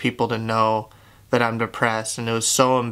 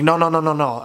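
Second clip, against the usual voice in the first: high, rapid-fire mild panic refusal; insistent protest; 5 semitones higher, 3 dB louder, faster than usual. Retold or imitated. Refusing the coworker's hospital plan, insistent protest. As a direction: wave it off in one rising run.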